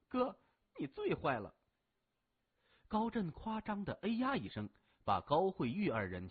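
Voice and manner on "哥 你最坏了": higher-pitched, pouty little-sister voice; coquettish. A narrator's reading. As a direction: playfully scold big brother with a pout. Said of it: "娇声说". (娇声撒娇)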